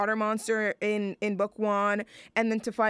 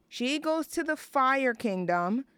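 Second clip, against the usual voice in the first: normal talking voice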